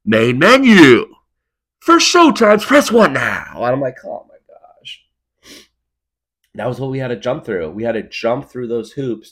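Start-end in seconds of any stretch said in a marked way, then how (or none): 0.0-3.5 much higher, much louder, slow booming announcer; movie phone voice. Retold or imitated. Kid doing the movie phone announcer for guests, slow booming. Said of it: "And then you're like". 4.0-5.0 whisper. Acted. Exasperated at phone menu.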